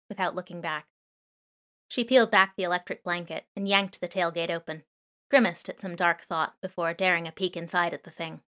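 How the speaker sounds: plain reading voice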